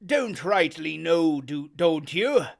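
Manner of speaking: loud, mocking, rising sailor; Silver berating. Silver badgers Morgan, loud and mocking. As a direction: needle him into talking.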